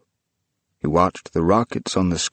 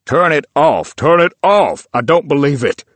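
loud, high, desperate cry; anguished shout; 8 semitones higher, 8 dB louder than usual. Acted. Tom shouting at the old man to turn the screen off. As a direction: shout it out in horror at your own grave. Tom horrified at his gravestone.

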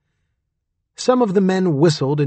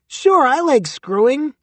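high squeak, a tiny talking dick; squeaky voice; 10 semitones higher than usual. A narrator's reading. Durden voicing his dick to the audience, high squeak. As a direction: squeak it up high like a cartoon critter. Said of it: "he squeaked".

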